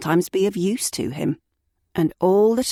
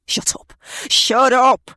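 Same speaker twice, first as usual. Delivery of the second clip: loud, higher furious yell; 8 semitones higher, 5 dB louder than usual. Acted. Ed yelling back at the begging woman, loud and furious. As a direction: bark it back at her. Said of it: "he yelled back".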